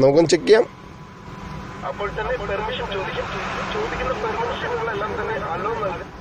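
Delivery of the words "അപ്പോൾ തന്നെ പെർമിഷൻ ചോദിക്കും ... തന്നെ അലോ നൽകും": faint played-back voice with echo added; echo effect. Shown as a demonstration. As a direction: voice message run through an echo filter, heard distant and much softer. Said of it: "നാം പറഞ്ഞത് എക്കോയോട് കൂടി കേൾക്കുവാനായിട്ട് സാധിക്കും".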